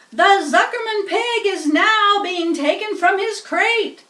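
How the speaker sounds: high, loud PA boom; booming loudspeaker. Acted. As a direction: fill the fairground with it. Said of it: "boomed the voice of the loudspeaker".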